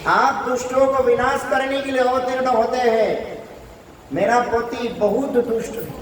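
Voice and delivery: acted entreaty, pitched high throughout; high, sustained appeal of imploring wives; mock tearful plea. Kaliya's wives beg the Lord, in a mock tearful plea. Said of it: "रो रो के कहने लगे".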